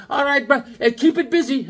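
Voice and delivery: high chummy voice with a laugh; Bobby's voice. Retold or imitated. Buddy signs off at the counter, high and laughing. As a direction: pitch it up, laughing, pal to pal. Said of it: "He goes".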